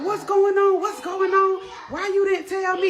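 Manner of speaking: high, loud, frantic questioning; frantic dad yelling. Retold or imitated. Dad rushing mom in the parking lot, frantic yell. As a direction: shout it like a panicked, betrayed father.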